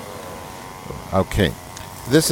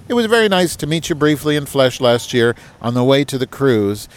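higher, formal letter-reading voice; email read aloud; 3 semitones higher than usual. Acted. Host reading Roger's email aloud to the audience, higher and formal. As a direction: put on a proper, correspondence tone.

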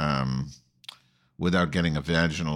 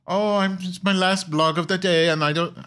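much higher, breathy-free lilting mock accent; Arianna Huffington impression; 12 semitones higher, 4 dB louder than usual. Host imitating Tracey Ullman's Arianna in bed, much higher mock voice. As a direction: play a weary socialite in bed, pitched way up. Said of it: "I can't do this impersonation".

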